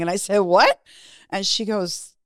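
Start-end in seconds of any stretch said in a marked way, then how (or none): 0.4-0.8 loud, startled retort; incredulous. Retold or imitated. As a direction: act out loud disbelief. Retells shock at missing belongings.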